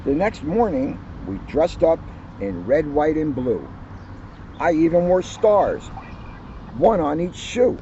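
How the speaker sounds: put-on cheerful children's book narration; story reading. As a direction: read it like story time for kids. Reads picture book aloud to audience.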